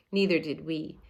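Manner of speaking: ordinary delivery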